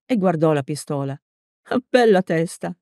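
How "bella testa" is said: ordinary delivery, nothing marked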